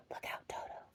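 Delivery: breathy whisper of a warning. Acted. Hippo whispering a warning to Toto. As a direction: hiss the warning under your breath. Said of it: "shouted Humpy Rumpy".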